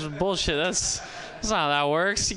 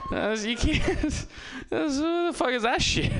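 higher, slowed, laughing disbelief; outraged at a fake prank, laughing incredulity; incredulous outrage; 11 semitones higher than usual. Real, not acted. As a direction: sputter in disbelief through laughs.

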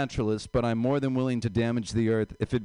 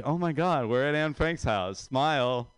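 higher, mock-excited selfie taker; gushing American tourist; 4 semitones higher, slower than usual. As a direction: gush it like a clueless tourist posing for a selfie. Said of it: "the Americans that were outside of Anne Frank's house taking a selfie like".

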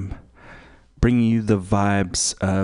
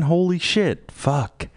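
shocked exclamation; 9 semitones higher, slower than usual. Real, not acted. Reacting to the eight-to-ten set time, shocked high voice. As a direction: pitch jumps high in disbelief.

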